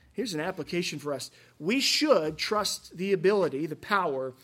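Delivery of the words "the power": ordinary talk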